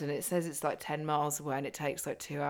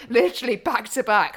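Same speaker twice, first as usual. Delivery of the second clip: laughing; 7 semitones higher, 10 dB louder than usual. Real, not acted. Laughing disbelief at the handshake.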